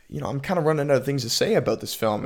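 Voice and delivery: rushed, fast words; talking so fast. Real, not acted. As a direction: rattle it off quickly. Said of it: "Sorry, I'm talking so fast".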